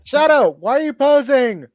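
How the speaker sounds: high, loud, exasperated question; girlfriend calling out. Acted. Girlfriend asks Shadow why he's posing, high and loud. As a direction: call out shrilly to the poser.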